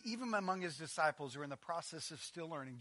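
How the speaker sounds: normal talking voice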